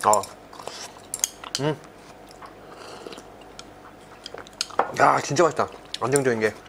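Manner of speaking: eating between words; talking while chewing. Real, not acted. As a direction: mouth partly full. Seen 1: Eats from bowl throughout; mouth seems to be working food while speaking.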